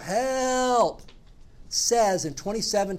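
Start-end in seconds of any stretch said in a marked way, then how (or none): 0.0-1.0 high, pleading cry of Help; prayer cry for help. Shown as a demonstration. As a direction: call out Help like a desperate prayer. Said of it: "the prayer of".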